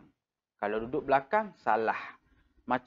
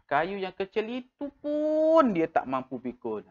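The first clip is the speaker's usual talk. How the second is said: pun lifted high and leaned on, sentence pitched up; emphasis on pun; 6 semitones higher than usual. Shown as a demonstration. Teacher demonstrating emphasis on pun. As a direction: stress the word pun in the sentence. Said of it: "Nampak tak saya buat penegasan dekat situ".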